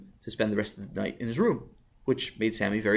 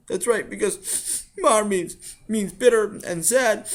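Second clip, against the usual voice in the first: put-on high-pitched child's voice, halting; Sammy, earnest upset boy; 9 semitones higher, 4 dB louder, slower than usual. Sammy explains his experiment to father, high and earnest.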